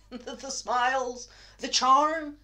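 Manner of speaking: high, loud and rising on each item; scornful outburst. Acted. Madman listing the false fronts people wear, scornful outburst.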